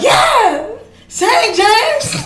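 high, loud cheering shout; church cheer. Retold or imitated. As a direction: whoop like a churchgoer hyping the singer. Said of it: "The women in church jumped up like".

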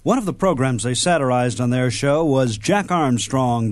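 ordinary delivery

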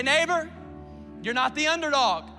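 normal talking voice

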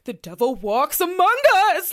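loud, high, far-off proclamation; doomsday believer. Acted. Host voicing a panicked townsperson's letter, proclaiming loudly. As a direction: cry it out like a wild-eyed prophet.

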